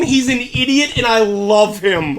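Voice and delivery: ordinary talk, nothing marked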